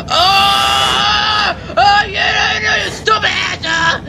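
high, yelling taunts; frantic yelling. Real, not acted. Gamer yelling at the chasing monster, frantic. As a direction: yell at the monster. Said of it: "screaming like that".

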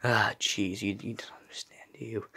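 fast hushed whisper. Acted. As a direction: whisper it quickly under breath. Aside muttered to himself.

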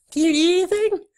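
high, squeaky critter voice; eager little beaver. Acted. Beaver offering to fetch things, eager high voice. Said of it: "says Billy eagerly".

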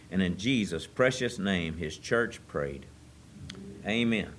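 low, soft, quick prayer voice. Acted. Pastor praying over the church service, soft and reverent. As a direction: pray humbly to the Father. Opening prayer before sermon.

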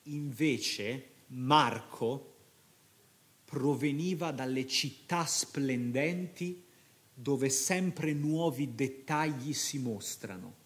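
slow, tender reading of a passage he loves; moved reading. Real, not acted. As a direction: read it slowly, touched by the words. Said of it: "tutte le volte che lo leggo mi commuovo".

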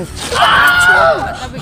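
laughing. Real, not acted. Girlfriend laughing, telling him stop.

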